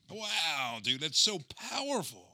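high-pitched, awed reaction. Real, not acted. Co-host reacting to the thief's answer, high and awed. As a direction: marvel at it, pitch lifted. Moved by the retold story.

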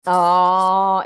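loud drawn-out delighted oh; really delighted oh. Real, not acted. Hearing her friend's daughter got the message, loud delighted oh. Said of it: "isn't that fantastic".

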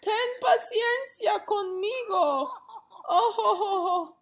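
high, loud begging with laughing sobs; pleading servant. Acted. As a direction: beg the master for mercy, half laughing, half crying. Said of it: "le suplicaba diciendo".

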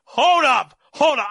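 loud, high-pitched interruption; excited shout. Real, not acted. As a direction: shout to stop everyone mid-flow. Interrupts excitedly before a name reveal.

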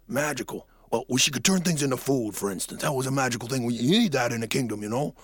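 put-on a rusty old king warming up; sleepy old king. Waking king talks to the boy.

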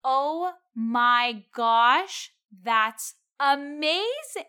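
drawn-out, loud, over-the-top gushing; obnoxious enthusiasm. Shown as a demonstration. Server gushing at a rude customer's order, with obnoxious slowed-down enthusiasm. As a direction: ham it up very slowly, like a kindergarten teacher. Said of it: "give them obnoxious enthusiasm".

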